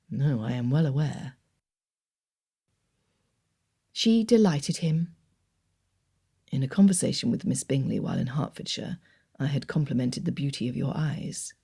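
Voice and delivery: Darcy answering his aunt; Darcy, reserved. A narrator's reading. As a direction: voice Darcy calm and proper. Darcy's lower voice.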